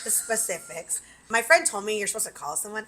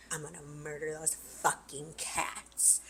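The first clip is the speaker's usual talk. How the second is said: seething whisper; 4 semitones lower, 10 dB quieter, slower than usual. Retold or imitated. Seething on the patio.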